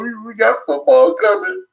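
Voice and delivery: giddy, cracking high with excitement; emotional hype. Real, not acted. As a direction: voice leaping way up with football excitement. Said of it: "I'm getting emotional".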